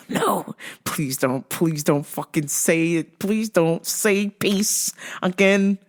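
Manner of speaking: drawn-out, dreading plea; slow pleading. Retold or imitated. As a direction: beg word by word, slowly. Pleading in own head.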